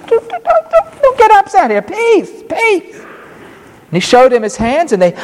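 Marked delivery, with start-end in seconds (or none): 0.1-3.0 much higher, coaxing reassurance; calm them down urgently. Acted. Jesus calming scared disciples, high and soothing.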